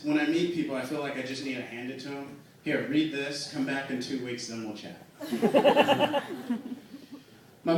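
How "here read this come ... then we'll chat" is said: usual speaking voice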